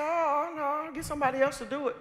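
high-pitched, quick mock refusal; retold squeamish no; comic refusal.

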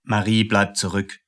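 ordinary delivery

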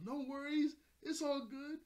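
high, loud cartoon-hero voice; carefree reassurance. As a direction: toss it off brightly as an easygoing hero. Luffy's carefree answer to the mayor.

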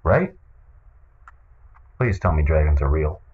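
ordinary talk, nothing marked

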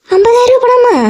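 can't believe how much money; shocked disbelief. Acted. Neighbour shocked at fifty thousand rupees.

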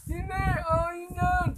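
high, slow insistent claim; high squabbling friend. Acted. One friend insisting to the other, high and slow. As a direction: claim it like a stubborn kid. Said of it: "Sabi ng isa".

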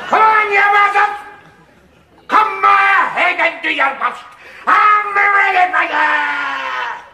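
high, loud taunting yell, slowing on the last words; mock-heroic battle cry, pitched high; defiant shout. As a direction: bellow a challenge at the buzzards. Taunting the police outside.

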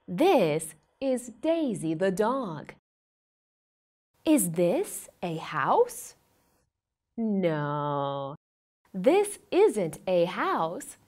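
put-on slow sing-song questions for a toddler; reading to a toddler. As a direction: ask it like a picture-book game. Quizzes toddler about pictures.

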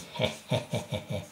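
soft, airy wicked chuckle; villainous cat snicker. Acted. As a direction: snicker like a scheming cartoon cat. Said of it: "sort of expression on his face".

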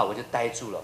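usual speaking voice

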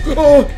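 loud, high "oh" of fright; startled scream. Real, not acted. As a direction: cry out in sudden shock. Jump scare in horror game.

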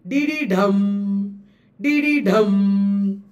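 dee dee dum, landing long on DUM; anapestic beat. Shown as a demonstration. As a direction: chant dee dee dum, weight on the last beat. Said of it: "Anapest means".

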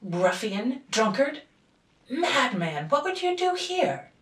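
father raging at the intruder; furious insults. A narrator's reading. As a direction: hurl the insults, building to a shriek. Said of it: "enraged father insults intruder".

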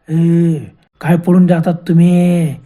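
slow, loud, drawn-out ghostly menace; hoarse ghost voice. Acted. Ghost calls after fleeing friends, slow hoarse menacing voice. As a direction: drag out each word like a menacing ghost. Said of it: "याचा घोघरा आवाज".

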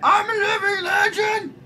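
gloating shout. Real, not acted. Gloating while playing.

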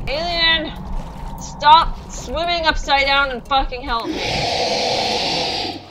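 loud, slow exasperated command; exasperated yell. Real, not acted. Stuck goat ordering the alien to stop swimming and help, slow exasperated yell. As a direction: drag out the demand in frustration.

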